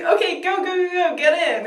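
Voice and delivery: high, loud, urgent hurrying; urgent Doctor imitation. Doctor urging visitors in.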